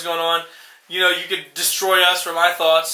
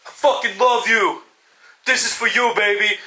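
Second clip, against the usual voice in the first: mock tender; 2 semitones higher than usual. Boxer on the canvas to his daughter, mock tender. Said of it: "his daughter and be like".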